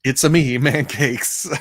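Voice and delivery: high, loud cartoon Italian accent; excited mock-Italian video game hero; Mario. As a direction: belt out a playful Italian caricature, breaking into laughter. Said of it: "automatically go Mario because it's almost cartoony".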